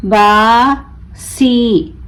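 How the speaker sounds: बासी very slow, long बा, pause, long सी; broken into syllables. Shown as a demonstration. Teacher breaking the word for learners, बा... सी stretched apart. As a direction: draw out बासी as two separate syllables. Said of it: "तोड़ तोड़ कर पढ़ूंगी".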